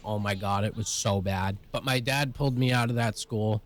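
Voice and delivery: plain reading voice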